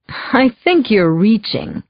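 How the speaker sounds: slow, lightly voiced condescending Michael. A narrator's reading. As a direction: talk down to her, unhurried. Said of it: "Almost condescending".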